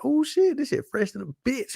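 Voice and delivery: high-pitched, impressed with yourself; acted high squeal of self-approval; self-admiring hype. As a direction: hype up your own outfit, pitch way up. Acted admiring own outfit.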